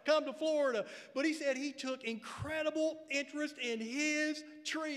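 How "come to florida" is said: louder, higher amused retelling; grown grandson. Retold or imitated. Preacher voicing the grown grandson, amused and louder. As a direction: tease the grandma's hint, bright and loud. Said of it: "And he goes".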